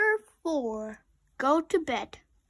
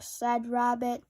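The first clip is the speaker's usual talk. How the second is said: ordinary delivery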